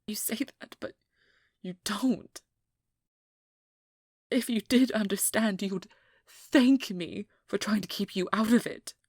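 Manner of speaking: angry outburst. Acted. Hunter losing patience with the vampire, shouting angrily. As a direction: snap back at them hard, full volume.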